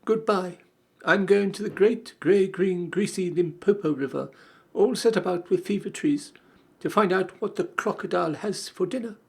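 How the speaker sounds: put-on high, pleading young elephant; a small curious child, complaining. Child says goodbye.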